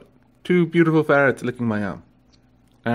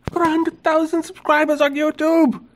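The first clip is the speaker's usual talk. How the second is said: much higher, gushing big news; excited announcement; 13 semitones higher than usual. Real, not acted. Owner telling her ferret the big subscriber news, excited. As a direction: announce it like a thrilled fan. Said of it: "you don't seem that impressed".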